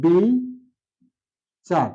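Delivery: অবিচার drawn out very slowly with open ɔ; slow model pronunciation. Shown as a demonstration. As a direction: stretch অবিচার like a teacher. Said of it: "সেজন্য".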